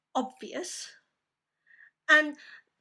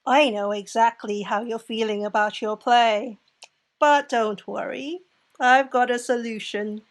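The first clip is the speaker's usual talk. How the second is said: Wednesday Addams type; 2 semitones lower, 8 dB louder than usual. Acted. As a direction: project it evenly, like an eerie saleswoman. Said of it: "She reminded me of Wednesday Addams".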